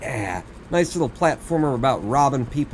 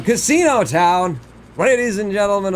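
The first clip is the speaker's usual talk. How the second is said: announcer voice; 8 semitones higher, 5 dB louder than usual. Acted. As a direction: proclaim it to the crowd. Announcing the destination.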